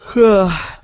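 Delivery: long weary exhale before the story; loud sigh through the mouth; heavy sigh. Real, not acted. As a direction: let out a tired sigh. Sighs before telling gun show story.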